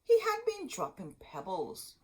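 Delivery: put-on lively read-aloud to kids; storybook reading. A narrator's reading. Teacher reading Hansel and Gretel aloud to children. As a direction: read the tale with gentle drama.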